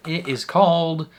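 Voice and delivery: hammed-up Southern title read; southern accent. Real, not acted. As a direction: announce the title with a Southern twang. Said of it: "I don't know why I'm speaking in a southern accent".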